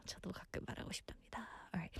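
hushed little confession; whispering. Real, not acted. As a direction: whisper it aside. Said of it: "whispered confession".

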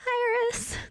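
high, squeaky-pitched imitation of fans; mock gushing. Imitating viewers answering that she is cute, mock gushing. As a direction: say the name way up high, teasingly.

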